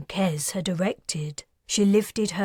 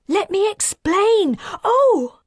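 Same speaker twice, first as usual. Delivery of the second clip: much higher, frantic woman's voice; panicked plea; 13 semitones higher, 6 dB louder than usual. A narrator's reading. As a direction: plead desperately, caught out. Woman pleads, then slapped.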